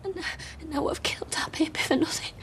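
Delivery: fast guilty whisper; rushing the words out in a whisper. Acted. Confessing the terrible truth to her husband in a whisper.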